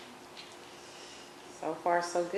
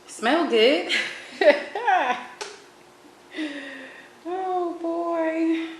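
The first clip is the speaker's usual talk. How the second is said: excited laughing; 12 semitones higher, 4 dB louder, much slower than usual. Real, not acted. Excited about cooked lobster.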